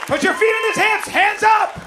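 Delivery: high, loud barked orders; shouted commands. Acted. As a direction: shout the instructions across the room. Shouted instructions from the crowd.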